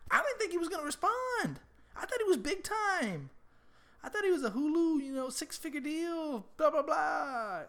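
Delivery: high-pitched, hammed-up self-defence; defensive protest. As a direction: plead your case in a high, exasperated voice. Protesting against the imagined criticism.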